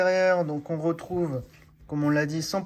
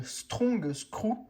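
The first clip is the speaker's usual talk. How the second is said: product name said with a put-on English accent, higher pitch; 6 semitones higher than usual. Presenting the screws to his colleague in a proud English accent. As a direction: say Strong Screw like a native English speaker. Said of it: "T'as un très bel accent, euh, anglais".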